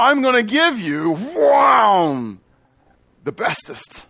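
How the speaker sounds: silly playful talk. Pretend. Pastor hamming up silly grammar for his congregation, playfully excited. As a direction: ham it up like a kid bragging. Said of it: "Marcia loves when I talk like that".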